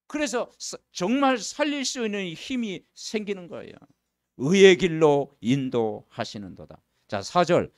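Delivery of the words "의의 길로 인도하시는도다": slow, loud, measured recitation; solemn scripture reading. Acted. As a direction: read the psalm slowly and gravely. Reads psalm aloud. (천천히 장엄한 성경 낭독)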